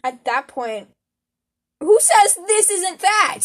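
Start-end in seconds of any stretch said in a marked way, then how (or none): 1.8-3.4 high, loud, slow rhetorical question; drawn-out awestruck question; dramatic wonder. Real, not acted. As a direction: pose it as a mind-blowing idea.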